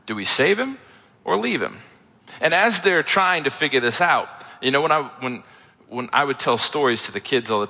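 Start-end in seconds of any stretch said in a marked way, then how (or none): 0.1-1.8 lightly acted weighing of two options, higher pitch; mock deliberating. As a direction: pitch swings up on the first option, then falls on the second. Sailors puzzling over Jonah overboard.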